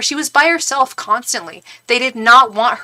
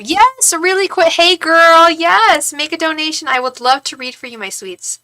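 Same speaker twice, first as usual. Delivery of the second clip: high, loud, delighted welcome settling down at the end; excited gushing; 7 semitones higher, 5 dB louder than usual. Real, not acted. Streamer breaks off to welcome a donor, excited gushing. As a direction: gush over a new arrival in a high voice.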